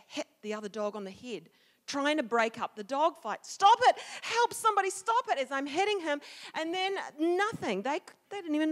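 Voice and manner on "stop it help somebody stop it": high-pitched frantic shout; yelling for help. Retold or imitated. Child breaking up dog fight, yelling for help. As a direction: cry out in the thick of it.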